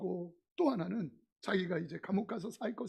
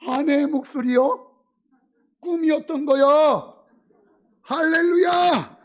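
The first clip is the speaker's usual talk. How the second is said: loud, high, slow triumphant cry; jubilant preaching; 6 semitones higher, 14 dB louder, slower than usual. Acted. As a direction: proclaim it with joy. Climax of preaching. (환희에 찬 설교 외침)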